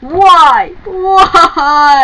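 loud, high cartoon wails of why; mock crying wail. Shadow laments the postcard, wailing in mock tears. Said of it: "Shadow's crying like a girl".

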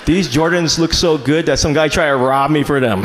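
normal talking voice

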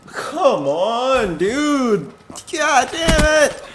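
high, drawn-out complaint; exasperated whine. Real, not acted. Player killed again before next shock, exasperated whine. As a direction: whine in frustrated disbelief.

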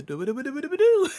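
rising run of "do"s into a high pitch; imitated repetitive noise. Teasing about a spammed fighting move, rising mock sound effect.

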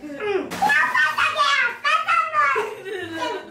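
slow, high squeaky voice; helium voice. Real, not acted. Helium game.

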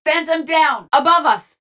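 combat shout. Acted. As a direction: shout urgently to the squad.

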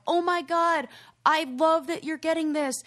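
high-pitched mock gushing; delighted friends. Kids happy for the girl getting her dog, delighted. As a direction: imitate friends thrilled for someone. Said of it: "in the moment, they're like".

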